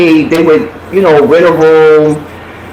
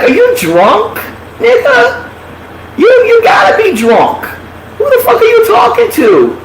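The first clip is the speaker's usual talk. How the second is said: can't believe he's serious; confronting the man who won't move, in high shouted disbelief; incredulous outrage. Retold or imitated.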